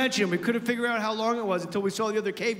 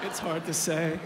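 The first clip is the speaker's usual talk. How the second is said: put-on low, deflated little boy; defeated kid mumble; 5 semitones lower than usual. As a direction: sigh it out like a beaten kid. Son answers a third time.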